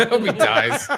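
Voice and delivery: laughing, high mock echo; laughing through the mock wish; laughing repeat. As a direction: echo it while cracking up.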